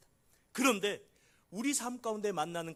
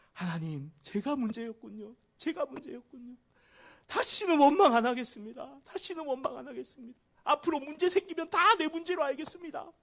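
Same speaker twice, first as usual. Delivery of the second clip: higher, pleading, a touch of weeping; tearful repentance; 10 semitones higher, 8 dB louder than usual. Retold or imitated. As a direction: confess in tears, voice rising. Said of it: "펑펑 울고 회개를 했대요". (높은 목소리로 울먹이며 회개하는 기도)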